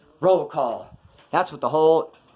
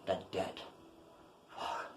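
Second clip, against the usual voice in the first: groggy whisper; 8 semitones lower, 19 dB quieter, slower than usual. Retold or imitated. Waking up to a debt.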